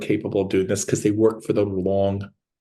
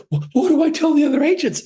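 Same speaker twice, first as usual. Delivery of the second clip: anxious client; 4 dB louder than usual. Retold or imitated.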